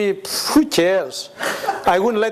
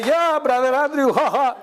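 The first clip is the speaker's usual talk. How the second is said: nervous churchy chuckle, higher; 7 semitones higher than usual. Retold or imitated. Dodging Brother Andrew's question with a fake pious laugh.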